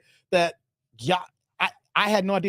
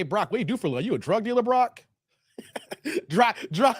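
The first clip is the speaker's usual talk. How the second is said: ordinary delivery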